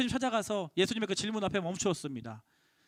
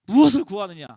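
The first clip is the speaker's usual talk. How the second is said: louder, weighty question; Jesus asking out of the blue; 3 semitones lower, 9 dB louder than usual. Acted. Preacher voicing Jesus' sudden question to Andrew, louder. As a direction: drop the question on him suddenly.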